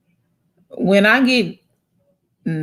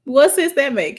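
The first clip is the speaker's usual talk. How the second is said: usual speaking voice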